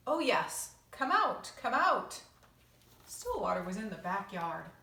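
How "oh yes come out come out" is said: calling out. Acted. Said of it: "she heard the voice say".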